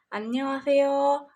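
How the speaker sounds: "अन्योंगसेयो" बोला गया धीरे, साफ़ कोरियन उच्चारण में; slow, careful Korean "अन्योंगसेयो", every syllable drawn out; Korean annyeonghaseyo. Shown as a demonstration. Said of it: "हाय को कोरियन लोग क्या बोलते हैं". (अन्योंगसेयो को धीरे-धीरे, कोरियन लहजे में)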